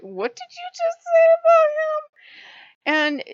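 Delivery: hammed-up outrage on a defender's behalf; outraged demand. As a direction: stretch it out in shrill offended disbelief. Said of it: "I'm like".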